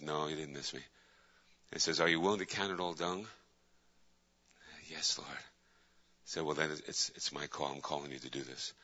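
low, very soft, gentle voicing of God; quiet divine answer. God answering the praying minister, quiet and low. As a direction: drop to a hushed, low reply.

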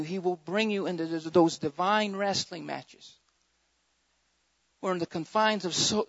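ordinary delivery

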